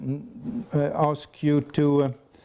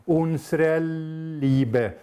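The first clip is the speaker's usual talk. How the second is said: "unsere" drawn out slowly before "Liebe"; slow demo, lingering on "unsere" to emphasise "Liebe"; lengthened upbeat; 3 semitones higher, much slower than usual. Shown as a demonstration. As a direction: hold the unstressed end of "unsere", delaying "Liebe". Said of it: "these lengthenings occur in very thought-provoking places".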